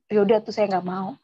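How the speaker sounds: normal talking voice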